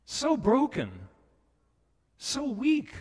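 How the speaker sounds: high, aching plea; high plea for the weak; pleading. Real, not acted. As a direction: cry out for the broken. Pleading, voice rises.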